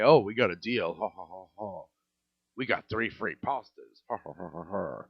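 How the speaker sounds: low, mocking laugh of smug customers; lightly voiced gloating diners. Customers bragging to hospital friends, mock smug laugh.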